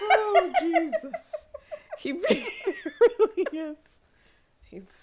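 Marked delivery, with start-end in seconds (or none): 0.0-3.8 high, slow, laughing squeal; real helpless laughter. Real, not acted. Host overcome by the brinks pun, laughing helplessly. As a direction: drag the words out through uncontrollable laughter.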